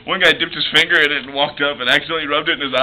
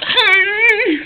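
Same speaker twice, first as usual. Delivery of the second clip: high, strained fake cry; a hammed-up helpless yelp; mock cry. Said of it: "Act out your wreck".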